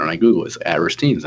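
ordinary talk

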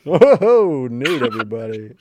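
loud, high cheering intro; hammed-up announcement. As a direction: hype up the guest. Hammy announcement of co-host.

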